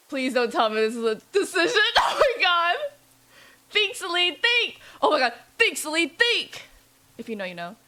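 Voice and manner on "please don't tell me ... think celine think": loud, high, half-laughing pleading; hammed-up urgent panic; comic panic. Streamer urges heroine before the truck hits, mock panicking.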